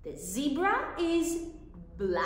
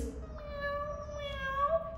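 high mewing meow; cat meow; 14 semitones higher, 5 dB quieter than usual. Shown as a demonstration. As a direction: meow like a kitten. Imitating cat sound.

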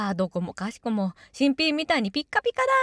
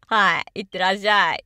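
ordinary talk

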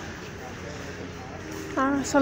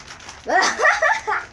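high laugh; 12 semitones higher than usual. Real, not acted. Laughs calling to mother.

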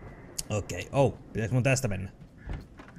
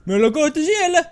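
mock Barry; 10 semitones higher, 3 dB louder than usual. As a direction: voice Barry higher and jumpy. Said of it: "se sanoo tuolle". (pilaileva Barry-ääni)

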